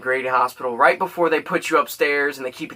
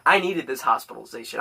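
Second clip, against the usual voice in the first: ordinary delivery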